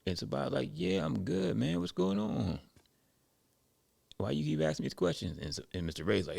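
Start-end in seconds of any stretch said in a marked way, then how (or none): 0.7-5.4 high-pitched, puzzled protest; mild exasperation. Retold or imitated. Sabata answers Mister Ray's repeated questions, mildly exasperated. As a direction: push back in a higher voice, imitating impatience.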